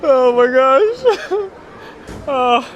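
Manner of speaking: breaking up with laughter mid-phrase; laughing fit. Real, not acted. Streamer cracking up at the clip, laughing hard. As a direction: squeeze it out through helpless laughter.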